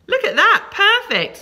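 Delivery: high, loud burst of delight; excited self-congratulation. Real, not acted. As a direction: proudly exclaim over your handiwork. Teacher delights in her own writing.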